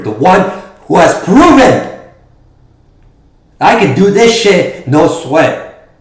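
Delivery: loud angry promo shout; wrestling promo. Acted. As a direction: blow up at the rival.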